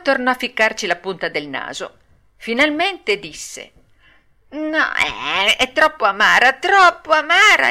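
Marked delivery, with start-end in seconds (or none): 4.5-5.6 lightly hammed-up refusal, higher; mock disgust. Pinocchio refuses the bitter medicine. 5.6-6.5 higher, louder puppet whine; acted complaint. Pinocchio complains of the bitter medicine. 6.5-7.7 much higher, loud hammed-up wail; escalated acted complaint.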